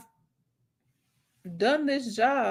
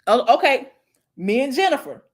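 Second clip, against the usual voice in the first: ordinary talk, nothing marked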